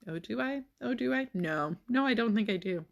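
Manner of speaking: high mocking "oh, do I?" then a flat no; sarcastic retort. Real, not acted. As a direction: throw the question back with scorn. Mocking reply to dad's voicemail.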